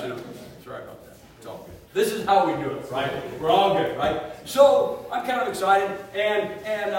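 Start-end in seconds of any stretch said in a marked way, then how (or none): 0.6-1.7 mumbled casual apology. Retold or imitated. Mimics brother's casual apology again.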